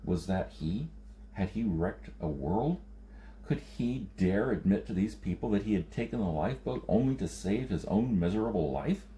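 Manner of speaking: plain reading voice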